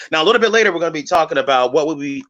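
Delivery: usual speaking voice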